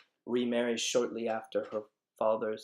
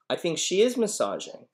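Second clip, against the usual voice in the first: ordinary talk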